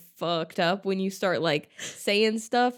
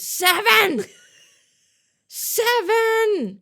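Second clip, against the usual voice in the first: much higher, loud stubborn screams; drunk screaming; 14 semitones higher, 6 dB louder, much slower than usual. Retold or imitated. Drunk Tracy yelling her order from the trunk, screaming. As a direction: shriek the number from the back of the car.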